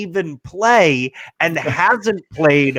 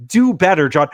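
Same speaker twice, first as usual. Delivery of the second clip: ordinary talk, nothing marked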